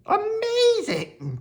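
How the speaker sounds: very high, drawn-out amazement; amazed child. Acted. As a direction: marvel slowly at the trick. Henry amazed by dog's tricks.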